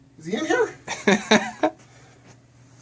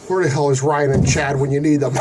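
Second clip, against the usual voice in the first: usual speaking voice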